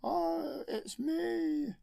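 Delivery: high, loud, cheerful wookiee yelp; Chewbacca roar. Retold or imitated. Chewbacca popping up to surprise his friends, high and loud. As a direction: roar then squeal a happy hello.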